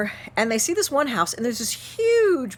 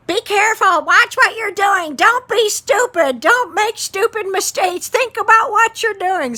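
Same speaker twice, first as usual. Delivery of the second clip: high, loud, slow nagging parent voice; lecturing dad; 12 semitones higher, 8 dB louder than usual. Retold or imitated. Dad warning his teenage son to be careful, loud high nagging. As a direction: wag a finger like a scolding father. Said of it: "I have heard up until that point".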